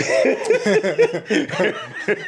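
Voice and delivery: high laugh while getting teary; embarrassed-at-tears laughter; emotional laugh. Real, not acted. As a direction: laugh through welling emotion. Laughs after admitting being teary.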